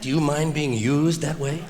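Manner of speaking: ordinary talk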